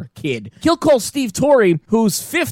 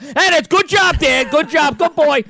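put-on higher, dismissive good boy; mock Russo; 6 semitones higher, slower than usual. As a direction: wave him off like Dog. Imitating Dog brushing off Eddie.

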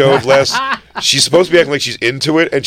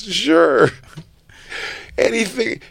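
high voice; 11 semitones higher, slower than usual. Retold or imitated. Voicing the woman agreeing.

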